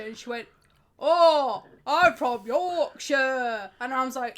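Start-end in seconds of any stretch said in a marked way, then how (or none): 1.0-3.7 loud, drawn-out mock Yorkshire; slow, bellowed Yorkshire caricature; bad Yorkshire accent. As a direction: ham up an awful Yorkshire impression. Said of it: "then she did Yorkshire".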